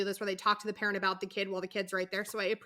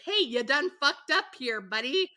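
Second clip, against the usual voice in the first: mock warning; 4 semitones higher, 4 dB louder than usual. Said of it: "indirectly to communicate".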